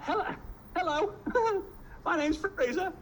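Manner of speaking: tiny high-pitched nervous hello; hammed-up nerves, very high voice with a giggle; mock timid greeting. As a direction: squeak it out like a scared student. Acts petrified greeting to Russian visitor.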